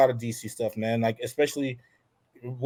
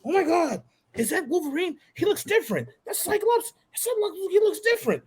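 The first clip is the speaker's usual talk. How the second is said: put-on high, eager little girl full of questions; excited little girl; 13 semitones higher than usual. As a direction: play the wide-eyed kid firing off questions. Daughter voiced in the story.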